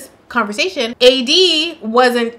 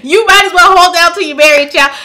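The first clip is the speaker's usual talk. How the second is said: louder, laughing through a mock auntie lecture; laughing mock advice; 6 semitones higher, 9 dB louder than usual. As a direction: giggle while scolding.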